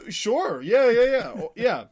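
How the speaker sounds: high, loud, gushing yeah yeah yeah; excited agreement. Real, not acted. Co-host suggests a hunky actor, she gushes in excited agreement.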